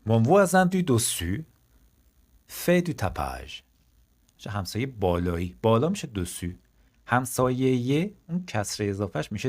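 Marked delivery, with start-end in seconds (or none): 0.1-3.6 slow, opening high and loud, then settling; slow model sentence. Shown as a demonstration. Teacher slowly announces the new sentence to the class. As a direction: stretch out voisin, then land fait du tapage. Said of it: "همسایهٔ بالایی من".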